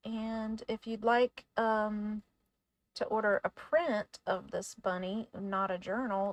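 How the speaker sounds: usual speaking voice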